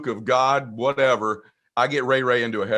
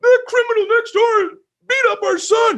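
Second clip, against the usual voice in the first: very high, loud mock mother; mother telling dad about the fight, high mock shriek; mom's outrage; 8 dB louder than usual. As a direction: shriek it as the upset mom. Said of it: "He goes".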